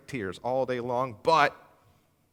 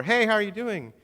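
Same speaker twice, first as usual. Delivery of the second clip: bright, higher small-talk hello; cheery greeting; 7 semitones higher than usual. Retold or imitated. Acquaintance at the grocery store, chipper. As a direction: chirp it like a friendly acquaintance. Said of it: "someone ask you at the grocery store".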